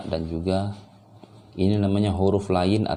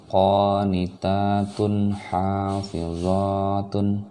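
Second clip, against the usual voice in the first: slow, measured recitation with drawn-out long vowels; Quranic reading drill; 4 semitones higher, much slower than usual. Shown as a demonstration. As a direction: recite it slowly, holding each long syllable. (bacaan mengaji pelan dengan mad dipanjangkan)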